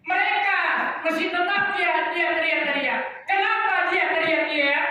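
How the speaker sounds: high, insistent preacher's voice; urgent preaching. Real, not acted. Preacher describes screaming in hell to congregation, high and insistent.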